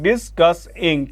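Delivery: "Discussing" slowly in three beats, dis, cus, ing; syllable by syllable. Shown as a demonstration. Teacher counting syllables for students, "discussing" slowly split in three. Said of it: "इस word में तीन syllable हैं".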